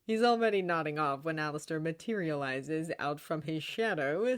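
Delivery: ordinary delivery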